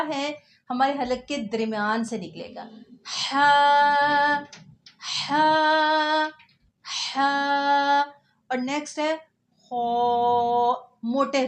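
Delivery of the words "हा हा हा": "हा" breathed clearly from the middle of the throat, loud. Shown as a demonstration. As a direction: push a clean "हा" from the throat. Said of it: "थोड़ा सा clearly बोलना है". ("हा" हलक के बीच से साफ़)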